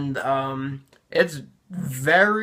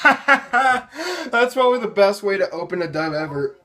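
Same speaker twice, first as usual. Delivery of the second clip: laughing; 4 semitones higher than usual. Real, not acted. Laughing at his old dub.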